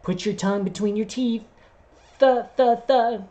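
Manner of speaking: teacher demonstrating. Retold or imitated. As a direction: coach the tongue through th, lightly mocking. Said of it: "lecture on how to do T H sounds".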